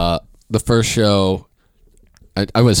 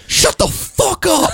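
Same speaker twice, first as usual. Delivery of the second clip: heckler shout; slower than usual. Retold or imitated. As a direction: bellow it like a drunk heckler. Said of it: "I remember a dude going".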